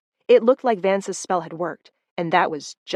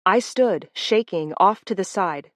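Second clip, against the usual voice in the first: usual speaking voice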